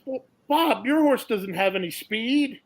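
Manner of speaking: one trainer tells the other how he'll win the bet; lightly voiced sly trainer. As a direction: deliver the scheme with a smirk. Second trainer's sly reply.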